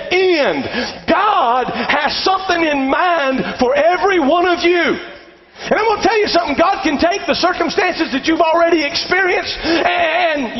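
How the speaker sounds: high, ringing pulpit exhortation; full-force preaching. Real, not acted. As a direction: drive the promise home to the congregation. Preaches to congregation.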